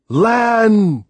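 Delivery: one high, loud, drawn-out cry; joyful shout. A narrator's reading. Sailor shouts on reaching land, joyful holler. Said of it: "he shouted".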